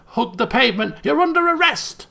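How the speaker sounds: high, loud mock cop command; hammed-up megaphone arrest; megaphone yell. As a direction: shout the arrest like a deranged cop. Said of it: "she yells into the megaphone".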